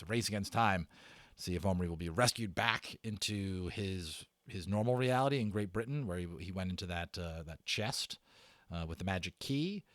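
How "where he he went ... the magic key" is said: ordinary delivery, nothing marked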